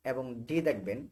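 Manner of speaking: usual speaking voice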